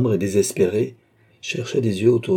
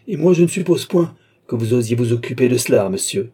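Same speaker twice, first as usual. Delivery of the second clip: louder, higher sharp retort; flashing indignation; 4 semitones higher, 6 dB louder than usual. A narrator's reading. Young woman forbidding him to meddle, indignant retort. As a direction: hit back forcefully from the first word. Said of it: "dont les yeux étincelèrent".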